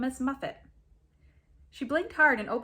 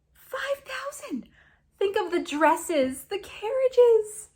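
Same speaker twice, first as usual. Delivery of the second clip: louder, rising to high, slow gushing squeals; excited cry; 4 semitones higher, 6 dB louder than usual. A narrator's reading. Girl gushing about a rich suitor, excited squeal. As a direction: swoon over the fortune. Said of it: "the brunette cried".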